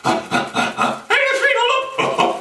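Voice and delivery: very high, slow yelp; high snake cry. Acted. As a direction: squeal it high. Snake bumps rabbit.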